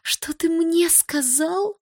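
slow, very high incredulous question; flabbergasted and affronted; stunned. A narrator's reading. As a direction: draw it out in outraged disbelief. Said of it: "ошалела та".

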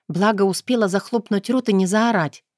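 plain reading voice

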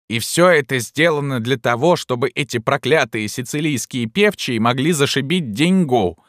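high, cutting outburst of indignation; sharp old woman's cry. A narrator's reading. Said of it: "резко крикнула старуха".

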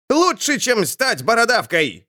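a high squealing outburst; shrieking sorcerer. Acted. Sorcerer squealing back at the hag, high and shrill. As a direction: squeal it, stung and indignant. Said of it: "взвизгнул колдун".

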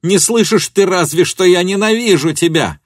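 high, loud cry of hatred; furious scream. A narrator's reading. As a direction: scream it at him in fury. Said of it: "закричала она".

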